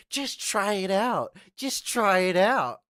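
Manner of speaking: high, loud, slow mock advice voice. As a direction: drag it out in a taunting parody. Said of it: "take the advice of".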